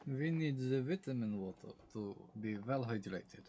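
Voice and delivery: poor mock Russian accent. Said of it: "my poor attempt at a Russian accent".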